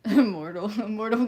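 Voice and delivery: loud laughing call of the name; laughing greeting. Real, not acted. Greeting chatter Immortal with a loud laugh.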